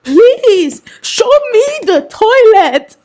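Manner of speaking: high, loud, drawn-out mock plea; hammed-up desperate begging, stretched and high; dramatic man imitation. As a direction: wail it slowly like an over-dramatic husband. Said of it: "the way he expresses himself is".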